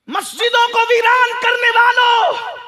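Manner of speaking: loud, high sustained shout; thundering preaching. Real, not acted. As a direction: cry out an accusation to the crowd. Shouted address to the congregation.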